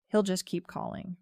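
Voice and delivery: plain reading voice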